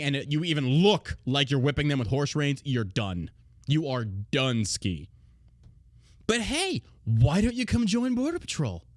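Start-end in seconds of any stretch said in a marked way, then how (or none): 6.3-8.8 higher mock-cheerful sales pitch; sarcastic recruiting pitch. Real, not acted. Mocking recruiters to the audience, higher and falsely cheerful. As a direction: sell it like a fake recruiter.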